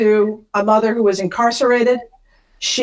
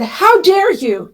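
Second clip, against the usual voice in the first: higher, slow, indignant "How dare you?"; a retold indignant scolding, pitched up; indignant rebuke; 8 semitones higher, slower than usual. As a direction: stretch it out with mock outrage, leaning on How. Angry man repeats his rebuke.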